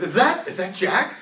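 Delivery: lightly voiced townsperson asking who it is; townsperson behind door. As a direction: call out a wary question through the door. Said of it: "somebody from the outside goes".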